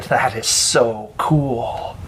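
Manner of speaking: awed admiration. A narrator's reading. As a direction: stretch it out in hushed awe. Kid admires friend's pirate costume.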